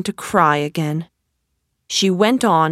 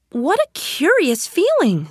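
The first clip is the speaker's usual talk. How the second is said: put-on little girl voice; 10 semitones higher than usual. A narrator's reading. Alice voiced in a story.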